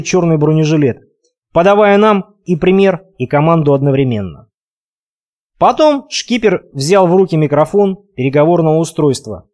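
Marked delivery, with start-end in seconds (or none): none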